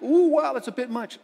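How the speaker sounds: high, loud "ooh" easing off; playfully taken aback; mock parental shock. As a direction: react like a startled parent. Parents reacting to a too-honest child.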